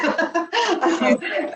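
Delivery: bright laughing burst; real laugh. Real, not acted. Host laughs at guest.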